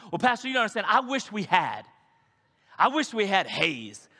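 high, loud pleading for stage haze; wistful complainer. Pretend. Member pleading with the pastor for stage haze, high whiny. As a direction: whine wishfully at the pastor.

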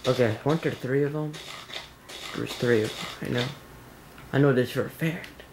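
ordinary delivery

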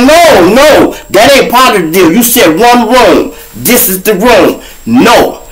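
high, loud angry refusal; snapping back. Retold or imitated. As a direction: snap a hard no at the cop. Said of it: "No, I snapped".

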